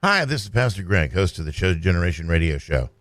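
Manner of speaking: normal talking voice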